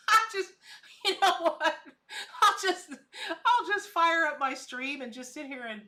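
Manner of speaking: laughing high and loud at herself; cracking up, tossing the words out. Real, not acted. Laughing at herself in front of her chat, loud and high.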